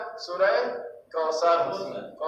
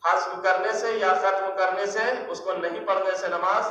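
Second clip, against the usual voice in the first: ordinary delivery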